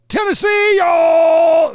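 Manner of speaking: very loud, high arena greeting; hype shout. Shown as a demonstration. Showing what the singer should have yelled, hype shout. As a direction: yell it to the back rows. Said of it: "he should have gone".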